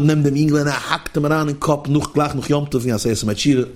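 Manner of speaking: usual speaking voice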